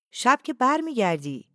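high, loud call from a balcony. A narrator's reading. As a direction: call your question across the yard. Wife calls from the balcony. (با صدای بلند و زیر، از دور صدا زدن)

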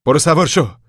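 loud, high command; barked order. A narrator's reading. Lawman sending Casey into the car, loud order.

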